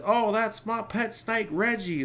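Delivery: high, bad Australian accent. Mimicking the pilot's Australian accent for a friend, playful. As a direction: ham up a wobbly Australian accent. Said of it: "he's Australian".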